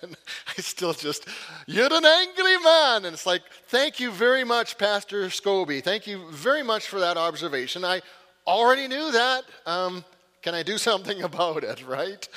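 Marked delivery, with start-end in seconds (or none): 1.6-3.1 slow, much higher and louder Scottish burr on angry; booming Scottish pastor voice; Scottish pastor accent. Retold or imitated. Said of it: "Scottish accent". 3.7-11.8 sarcastic thanks. Retold or imitated. Sarcastic reply to the pastor.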